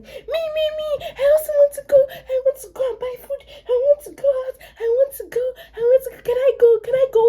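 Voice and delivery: put-on very high, childlike pleading shout. Ife butts in begging to come along, high hammed-up shouting. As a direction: imitate an over-eager youngster clamouring at the top of the voice. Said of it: "Ife just started shouting".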